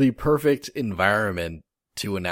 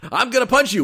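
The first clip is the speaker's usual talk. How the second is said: much higher, louder cartoon taunt; high mock fighter taunt; 7 dB louder, much faster than usual. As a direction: squeak out a fighter's threat.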